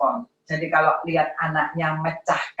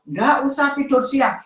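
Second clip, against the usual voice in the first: mother's contradiction; 8 semitones higher, 3 dB louder than usual. Retold or imitated. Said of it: "mamanya bilang".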